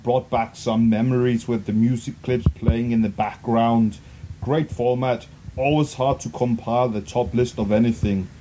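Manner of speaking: put-on low-pitched Austrian accent; deep mock-Austrian movie-star voice; famous Austrian actor impression. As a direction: read it like an Austrian action star. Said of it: "read this in the style of, um, a very famous Austrian, uh, actor".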